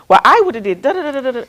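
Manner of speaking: imitated know-it-all babble; mock client protest. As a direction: mimic a client going on and on. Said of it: "they're just like".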